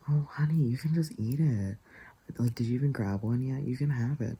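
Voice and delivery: mock-coax, lifted in pitch and lively; coaxing friend. Friend offering her the last one, coaxing.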